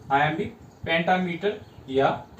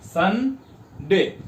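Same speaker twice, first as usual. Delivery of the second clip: split into syllables; much slower than usual. Shown as a demonstration. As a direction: say "सन" and "डे" as two distinct units. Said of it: "एक सन और दूसरा डे".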